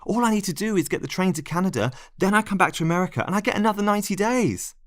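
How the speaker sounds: high, fast, eager pleading; rushing to sell him the plan. Acted. Narrator to Lionel, pitching the plan, fast and eager.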